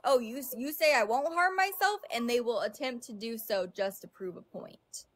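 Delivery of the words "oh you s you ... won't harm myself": acted-out defiant question, rising high; mock challenge. Imitating someone out to prove a point, mock challenge. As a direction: throw it back like a dare, pitched up. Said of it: "Like it's a test".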